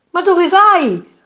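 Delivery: high, loud, drawn-out call; shouted question. A narrator's reading. Man to his departing nose, shouted question. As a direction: yell after someone, stretched and high. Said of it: "gridò il signore".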